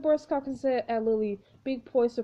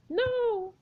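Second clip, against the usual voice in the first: sharp cry; 11 semitones higher than usual. Acted. Player cries out at Jane's attack.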